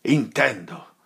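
suddenly loud and troubled; agitated outburst. Acted. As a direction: cut in, upset. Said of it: "stravolgendo gli occhi e cambiandosi tutto in viso".

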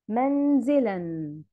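slow "منزلًا", ending in a clear n; tanween as spoken n. Shown as a demonstration. Teacher demonstrates the written word, slowly ending "منزلًا" on an n. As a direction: draw out "منزلًا" as manzilan, n sounded. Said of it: "أنا نطقتها بالشكل ده".

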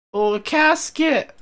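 high, stretched cry of delight; excited discovery. Real, not acted. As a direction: exclaim slowly with pitch leaping up. Calls out a find.

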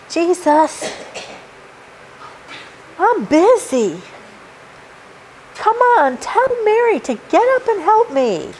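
high, pleading Martha complaining she is busy; exasperated Martha. Acted. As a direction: play Martha fed up and pleading with Jesus. Martha voiced complaining to Jesus.

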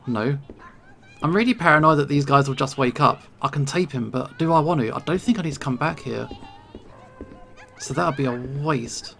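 normal talking voice

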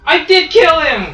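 high, loud and slow insistence; insist, offended; indignant shout. Real, not acted. Arguing over a teammate kill.

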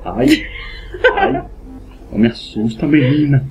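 usual speaking voice

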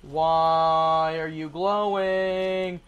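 very slow, stretched-out puzzlement; drawn-out question. Real, not acted. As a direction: stretch out each word in bewilderment. Repeats question drawn right out.

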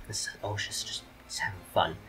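unvoiced whisper aside. Real, not acted. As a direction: whisper it under your breath.

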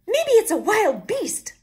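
high, loud squeal; squealing cub. A narrator's reading. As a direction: squeal it like a scared little bear cub. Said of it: "Little Wee Bear squealed".

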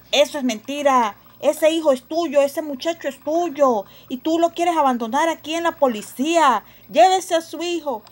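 loud stern accusation; a cop refusing to believe her; accusing policeman. Acted. Policía acusa a Sophie. (policía enojado, acusa en voz alta)

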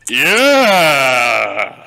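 loud shouted yeah; triumphant shout. Real, not acted. Player shouting after a good landing, loud. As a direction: yell it in triumph.

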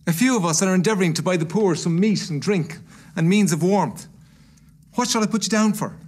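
high, loud, earnest appeal; charity gentleman. Acted.

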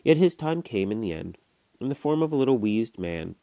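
usual speaking voice

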